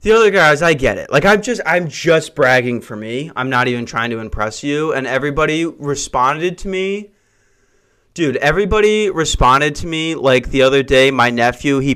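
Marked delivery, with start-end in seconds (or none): none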